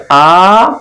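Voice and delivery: "आ" drawn out long; stretched vowel. Shown as a demonstration. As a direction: hold "आ" as a steady aaa. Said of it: "आ को खींच रहे हैं".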